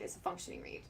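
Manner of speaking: whispered emphasis on a functioning reed; a confiding whisper. Real, not acted. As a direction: drop to a whisper to drive it home. Emphatic aside repeating point.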